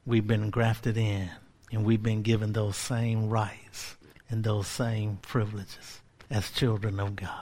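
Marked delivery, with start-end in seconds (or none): none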